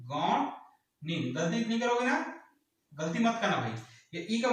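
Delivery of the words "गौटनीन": गौटनीन drawn out very slowly and clearly; slow model answer. Shown as a demonstration. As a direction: spell out गौटनीन slowly for students to repeat. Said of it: "क्या बोलेंगे?". (गौटनीन को बहुत धीरे, साफ़-साफ़ बोलें)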